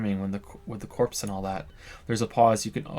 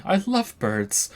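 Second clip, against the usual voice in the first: high pitched and drawn out; high, slow earnest; 8 semitones higher, much slower than usual. Acted. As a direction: say it high and slow like you mean it. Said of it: "sound anything like me".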